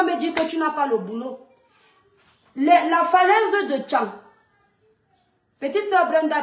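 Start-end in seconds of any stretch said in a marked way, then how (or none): none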